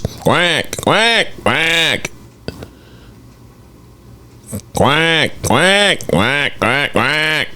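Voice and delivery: high quacking duck noises; mock duck quacks while watching the pass. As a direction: quack along as the ball flies. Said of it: "Look, watch".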